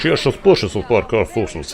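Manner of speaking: mumbled fake-Slavic gibberish; mock Slavic accent, dropping away; random Slavic accent. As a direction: slur it into pretend Slavic. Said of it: "a random Slavic accent".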